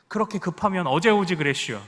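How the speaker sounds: a mild jab in Chungcheong dialect; 충청도 사투리로 능청스럽게. Retold or imitated. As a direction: tease the honking driver in Chungcheong style. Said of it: "이것이 충청도 화법이죠".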